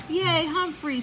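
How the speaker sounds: high cheerful yay; mild shriek of joy. Acted. Simon cheering the picture idea, a mild shriek. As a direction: cheer for the plan. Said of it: "Simon shrieked".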